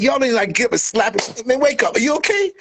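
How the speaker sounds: high, hammed-up mock worry and scolding. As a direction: pretend to check a choking man. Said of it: "I'm like".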